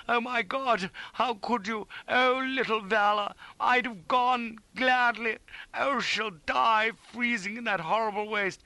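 high, slow anguished lament; sobbing in torment. A narrator's reading. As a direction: wail like a man in torment. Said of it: "sobbing like a man in torment".

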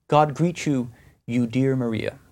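ordinary talk

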